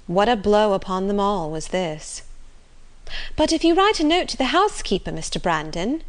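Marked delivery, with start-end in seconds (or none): 3.3-6.0 high, quick, eager plea. A narrator's reading. Marianne pleading with Brandon to save the outing, eager. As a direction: rush the suggestion out in a raised pitch. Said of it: "said Marianne eagerly".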